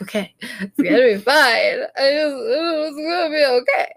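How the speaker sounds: a touch of crying as she talks herself down; tearful self-reassurance. Retold or imitated. Anxious mom in the car before the appointment, half crying. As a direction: fight back tears, it's gonna be okay.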